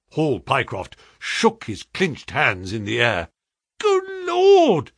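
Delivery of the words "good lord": high, loud exclamation; distressed cry. A narrator's reading. Clerk shocked at being fooled, crying out. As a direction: cry out at a much higher pitch. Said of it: "he cried".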